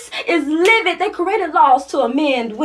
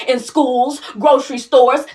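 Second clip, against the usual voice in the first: ordinary delivery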